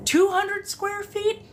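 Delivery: high, slow, drawn-out disbelief; incredulous retort. Acted. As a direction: stretch it out high in mock disbelief. Acted disbelieving retort to the brag.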